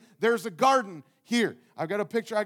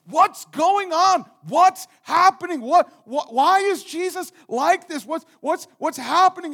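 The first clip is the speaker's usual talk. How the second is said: loud, anxious rush of questions, higher; worried outburst; 7 semitones higher, 9 dB louder than usual. Retold or imitated. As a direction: blurt it out in alarmed worry, loud and high. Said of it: "You're like".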